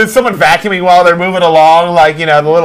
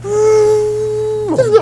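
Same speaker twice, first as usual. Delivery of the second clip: mock crying; much slower than usual. Mock wail about plane.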